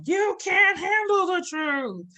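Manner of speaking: slow, high, booming courtroom yell; famous movie line shout. Retold or imitated. Therapist quoting the famous movie line to listeners, big slow shout. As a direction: belt the movie line like the outraged witness. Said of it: "that famous movie line".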